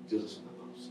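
breathy whispered pause; hushed whisper. Acted. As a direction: drop to a secret whisper before the pause. Preacher drops to a whisper.

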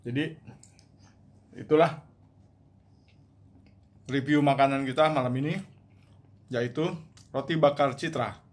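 talking while chewing; speaking around a mouthful; mouth full of bread. Real, not acted. Seen 3: Bread bits visible in mouth while talking; biting happened just before.

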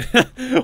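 high, loud laugh; quick laugh burst. Real, not acted. Laughs at bald men.

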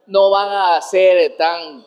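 usual speaking voice

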